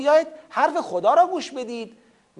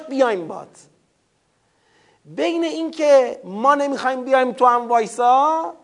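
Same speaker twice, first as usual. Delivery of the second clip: usual speaking voice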